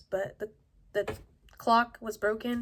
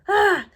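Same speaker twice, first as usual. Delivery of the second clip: frustrated yell; 11 semitones higher, 14 dB louder than usual. Retold or imitated. Retold panic when the call came through.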